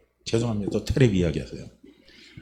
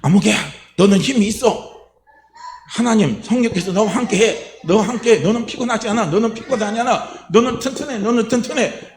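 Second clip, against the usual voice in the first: pastor cheering himself; 6 semitones higher than usual. Retold or imitated. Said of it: "자기가 그런 말을 해요".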